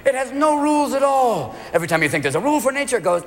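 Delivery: ordinary talk, nothing marked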